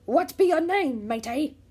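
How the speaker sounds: loud high pirate cry; pirate shocked at the wrong beach; pirate exclaim. Acted. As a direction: shout it like a startled captain. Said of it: "the head pirate asked".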